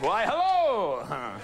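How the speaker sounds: slow drawn-out flirty hello; coy flirty greeting. Retold or imitated. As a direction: stretch it out, playfully coy. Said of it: "Can't you see him like".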